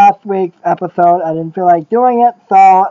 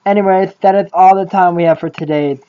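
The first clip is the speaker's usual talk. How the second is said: ordinary talk, nothing marked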